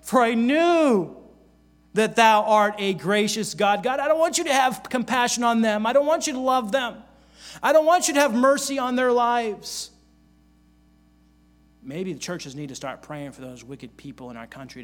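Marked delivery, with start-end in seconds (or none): 0.0-9.9 voicing Jonah. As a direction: whine it out as the sulking prophet. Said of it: "here's what he said".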